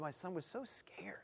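hushed whisper. Acted. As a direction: breathe it out like a secret. Hushed retelling of father's words.

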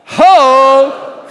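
loud, high shouted call; prophet's cry. Shown as a demonstration. Preacher voicing Isaiah's call, loud shout. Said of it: "Come on, one more time".

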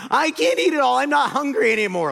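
put-on high, loud kid's excuse; child claiming to be full before dessert. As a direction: imitate the kid dodging his dinner.

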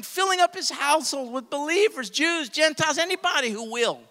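loud, high, repeating "believers" rhythmically; preaching at full force. Real, not acted. Preacher to congregation, loud fervent chant of "believers". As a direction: keep the fire going.

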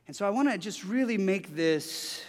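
usual speaking voice

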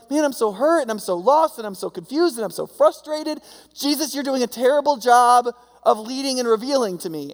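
hammed-up frustrated believer; mock whiny complaint. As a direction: whine at Jesus like a sulking kid.

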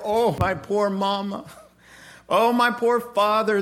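high, hammed-up weepy lament; mock sentimental son. Preacher mocks the repentant son's fake tears, hammed up. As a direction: overplay the tearful sorry son. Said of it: "He didn't sit there and go".

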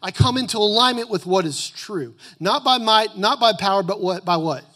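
high, loud emphatic preaching; booming motivational speech. Acted. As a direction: preach it hard and rising. Preaching at full force.

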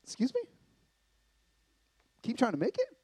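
high, disbelieving question rising at the end; incredulous Danny. Retold or imitated. Preacher voicing Danny's disbelief at the advice. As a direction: act out his baffled reaction. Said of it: "him looking at me like".

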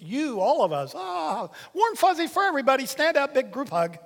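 high, loud, fast sarcastic gushing; mocking gushy tone. Preacher mocks how people hear verses, high sarcastic gush. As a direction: send up the group-hug reading.

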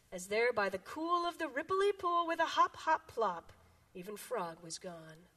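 lively picture-book read-aloud; reading to children. Acted. Children's time pastor reading a picture book, bouncy. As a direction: read it to the kids with big animal voices.

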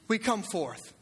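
ordinary delivery

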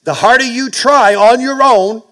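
high, loud booming declaration; voice of God. Acted. Pastor voicing God to his congregation, loud and high. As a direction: proclaim it like God speaking down. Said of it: "God's saying".

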